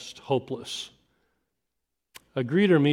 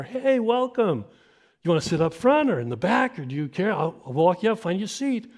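high, chipper welcome; cheerful usher; 5 semitones higher than usual. Retold or imitated. Usher welcoming the parents at the door, high and chipper. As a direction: sell the seat with a grin. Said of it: "usher greets him at the door".

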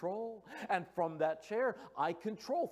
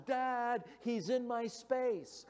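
put-on higher, slow whiny child calling Dad; daughter hollering; 7 semitones higher, much slower than usual. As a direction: wail it like a kid tattling from the back seat. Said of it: "Lydia hollers".

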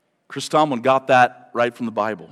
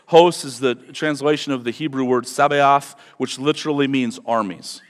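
ordinary delivery

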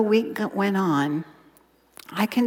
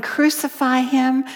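loud, raised crowd cry; mock crowd yell; 6 semitones higher, 4 dB louder than usual. Preacher voices the crowd yelling at Jesus, loud and raised. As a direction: voice the mob yelling it.

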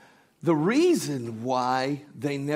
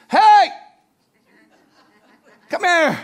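very high, very loud squawked call; bird shouting; 12 dB louder than usual. Acted. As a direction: yell sharply across the shop. Bird shouts at man again.

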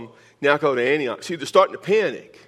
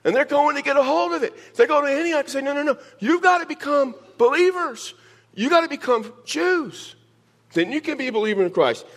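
high, insistent mock voice of the legalists; a hammed-up higher voice of the old-guard believers; mock Judaizers; 12 semitones higher than usual. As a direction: play the rigid traditionalists pushing their rules. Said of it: "these people are saying".

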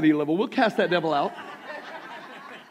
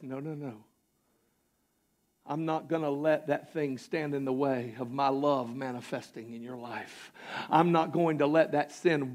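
much lower and softer, gentle voice of God; soft, tender God; 5 semitones lower, 7 dB quieter than usual. Retold or imitated. God gently refusing the sin, soft and tender. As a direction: speak God's words quietly and tenderly. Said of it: "God says".

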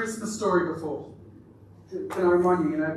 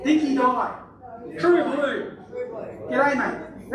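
higher, punchy Aussie slang delivery; Aussie accent; 4 semitones higher, slower than usual. Pretend. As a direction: ham up the Aussie catchphrases.

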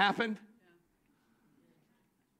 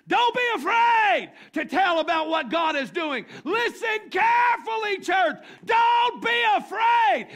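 shouted, much higher and louder, slowing at the end; preaching at full force; 11 semitones higher, 10 dB louder, slower than usual. Acted. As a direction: roar the call to the church. Preaching climax.

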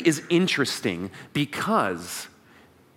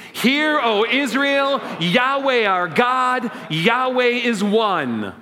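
slow, higher and louder group proclamation; leading a recitation; 6 semitones higher, 3 dB louder, much slower than usual. Shown as a demonstration. Pastor leading the congregation in the Shema, slow and loud. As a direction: lead everyone in a solemn creed. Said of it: "say it in English with me".

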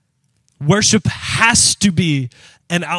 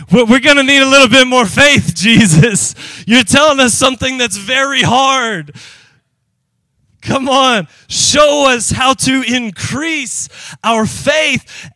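higher, louder pleading voice; pleading disciples; 5 semitones higher, 3 dB louder than usual. Retold or imitated. Disciples pleading with Jesus for more faith, louder and higher. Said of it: "The apostles said to the Lord".

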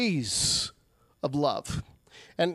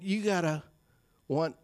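intimate plea; 6 semitones lower, 7 dB quieter, slower than usual. Retold or imitated. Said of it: "Jesus is saying".

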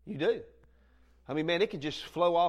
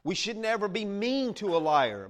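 normal talking voice

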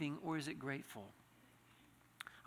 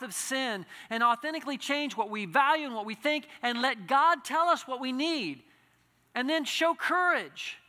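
louder and higher fervent preaching, Jesus speaking to the man; impassioned preaching; 8 semitones higher, 9 dB louder than usual. Acted. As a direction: pour out the call with rising fervour. Altar call to congregation.

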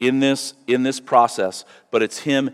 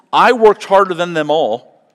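"worked" punched louder and higher; louder emphatic quote; 5 semitones higher, 7 dB louder than usual. Shown as a demonstration. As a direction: proclaim Paul's words with force.

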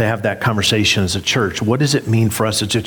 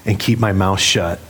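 ordinary talk, nothing marked

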